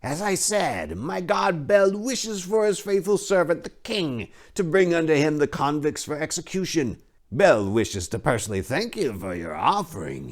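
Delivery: high, oily priest voice; a leering, smug high priest; sly high priest. Acted. High priest placating the king's men, high and oily.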